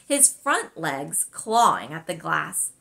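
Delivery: ordinary delivery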